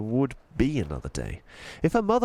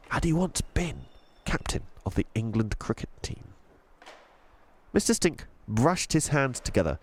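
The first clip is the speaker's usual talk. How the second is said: usual speaking voice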